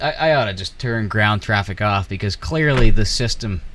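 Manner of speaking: normal talking voice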